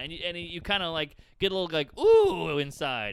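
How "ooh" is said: high, loud "ooh" of glee; sudden gleeful squeal of ooh; thrilled ooh. Acted. Audience member feeling better inside, thrilled ooh.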